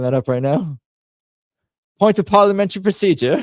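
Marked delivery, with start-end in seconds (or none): 2.0-3.4 put-on high, fast pompous British accent. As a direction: raise a point of order like a stuffy MP. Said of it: "do the accent".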